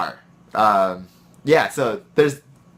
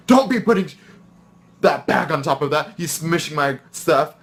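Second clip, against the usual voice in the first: higher, louder imitated outburst; blowing up; 5 semitones higher, 4 dB louder than usual. Coworker blowing up at him over chips, imitated high outburst. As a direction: mimic her sudden blow-up over the bag. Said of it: "blowing up in front of me".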